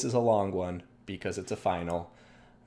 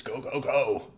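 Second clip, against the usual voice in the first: StarCraft Marine voice; 5 semitones higher, slower than usual. Pretend. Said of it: "That's like my StarCraft Marine voice".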